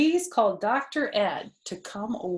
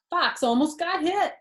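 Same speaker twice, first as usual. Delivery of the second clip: ordinary delivery, nothing marked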